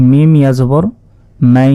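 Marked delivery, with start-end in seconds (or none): 1.5-1.8 "মাই" with its glide held in the nose; nasalised ghunnah. Shown as a demonstration. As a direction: hum out the end of "মাই", long and level. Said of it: "গুন্না করে পড়তে হবে". ("মাই"-তে গুন্না)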